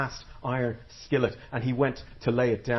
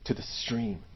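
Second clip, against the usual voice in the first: menacing whisper; 7 dB quieter than usual. Acted. Woman ends her threat.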